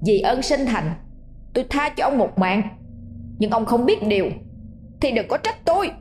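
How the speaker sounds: put-on a ghost boy warning his father through clenched teeth; menacing ghost child. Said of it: "Nó gằn giọng".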